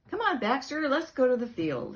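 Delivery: high, loud call. Acted. Boy calling his dog to come play, loud call. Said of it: "he called".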